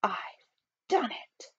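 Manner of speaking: soft, nearly whispered announcement; very quiet, halting exclamation; hushed triumph. Acted. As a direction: breathe it out in awed triumph. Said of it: "cried the chief financial advisor".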